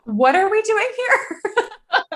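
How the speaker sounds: exasperated. Real, not acted. Mock exasperated outburst.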